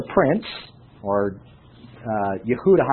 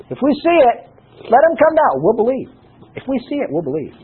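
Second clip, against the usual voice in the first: high-pitched mock promise, lightly acted; lightly acted priests; 8 semitones higher, faster than usual. Preacher quoting the wicked priests to the congregation, high lightly mimicking voice.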